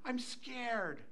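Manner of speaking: put-on small, soft little kid voice; scared grandchild. Frightened grandchild to grandpa at night, small soft voice. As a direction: imitate a frightened child quietly.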